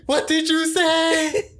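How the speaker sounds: high, loud, slow scandalized mom; shocked mom. Retold or imitated. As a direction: play the mother who can't believe her ears. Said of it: "my mom just comes, like, hopping in".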